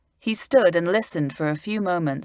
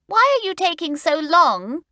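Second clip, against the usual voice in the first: put-on much higher impatient boy; Stan impatient; 12 semitones higher, 4 dB louder than usual. A narrator's reading. Stan stuck behind the girls, impatient. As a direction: complain about the wait. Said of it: "asked Stan".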